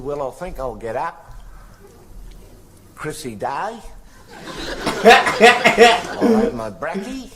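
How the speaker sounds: laid-back Australian slang, lightly voiced; playing the Australian bloke rattling off his Christmas plans; Aussie mate voice. Australian bloke telling a visitor his Christmas plans, light Australian twang. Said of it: "they add I E onto a lot of words".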